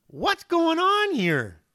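high, slow, incredulous question; baffled disbelief. Acted. Preacher to congregation, baffled and drawn out. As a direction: act out the listener's bafflement.

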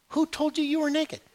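high pitched probing question; God asking. Acted. God pressing Adam on who told him, high. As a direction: lean on WHO.

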